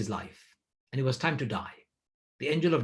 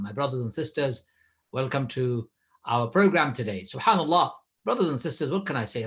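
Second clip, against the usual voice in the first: ordinary talk, nothing marked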